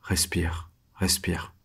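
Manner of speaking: soft whisper to himself; whispered self-calming. Acted. Freezing prisoner calming himself in his cell, soft whisper. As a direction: whisper to steady your own breathing. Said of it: "Faut que je me calme".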